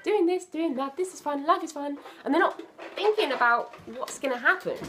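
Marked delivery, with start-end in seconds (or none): 0.0-2.0 put-on high, breezy la-la of a heedless kid; carefree child singsong. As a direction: play a careless little kid. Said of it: "they're just going".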